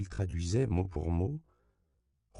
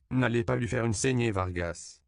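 sharp raised interruption; interrupting warning; 6 semitones higher, 4 dB louder than usual. A narrator's reading. Father Octavio cutting in to warn Vargas, raised. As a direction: cut in over him to stop the cut. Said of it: "Le père Octavio l'interrompit".

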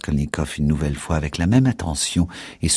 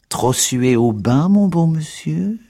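voix de vieille, plus aiguë et lente; vieille moqueuse; 11 semitones higher, 4 dB louder, slower than usual. A narrator's reading. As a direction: faire la vieille qui raille, en traînant. Old woman mocks pale visitor. (vieille usurière narquoise, aiguë et lente)

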